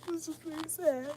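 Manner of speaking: mock sad whimper. Shown as a demonstration. Telling viewers about the dental delay, mock pitiful and soft. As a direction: play up the sadness as a joke.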